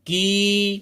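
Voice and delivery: की with a long held ई; modelling a long की. Shown as a demonstration. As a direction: stretch की. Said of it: "तो क्या पढ़ेंगे?".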